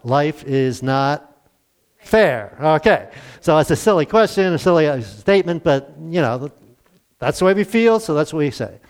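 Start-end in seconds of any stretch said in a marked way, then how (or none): 0.0-2.6 very slow, each word apart; slow parental saying. Retold or imitated. Parent telling kids a familiar lesson, slow and deliberate. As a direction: lay down the old rule. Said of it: "we always told our kids what".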